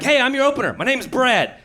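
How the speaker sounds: high, eager office guy; bright keen greeting from an overeager amateur; eager opener. Retold or imitated. Overeager coworker greets the comedian, high and eager.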